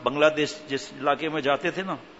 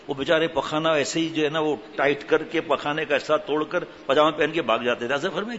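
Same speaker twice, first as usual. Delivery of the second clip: ordinary talk, nothing marked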